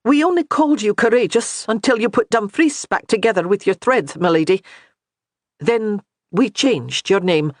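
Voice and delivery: a touch of a maid slyly building to a reveal; lightly voiced Highland maid.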